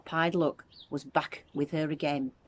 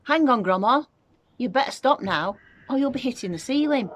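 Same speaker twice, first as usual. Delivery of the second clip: loud, high alarmed shouting; shouted; 8 semitones higher, 7 dB louder than usual. A narrator's reading. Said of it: "George gave a jump and shouted".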